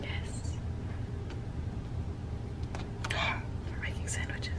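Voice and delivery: hushed whisper. Real, not acted. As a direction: whisper it like a secret. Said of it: "Why are we whispering?".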